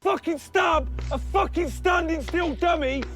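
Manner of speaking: ordinary talk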